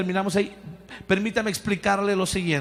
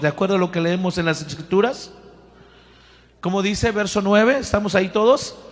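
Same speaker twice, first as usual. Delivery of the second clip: normal talking voice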